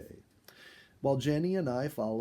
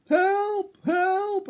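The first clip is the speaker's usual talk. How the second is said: shrill, much higher cry for help, loud; calling for help; 11 dB louder than usual. A narrator's reading. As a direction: yell for help from under a pile of raccoons. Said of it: "I called to Jenny".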